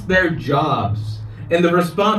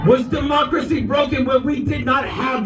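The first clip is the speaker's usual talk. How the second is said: high, shouted protest cry; roaring rallying speech; 7 semitones higher than usual. Real, not acted. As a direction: rouse the crowd, full voice.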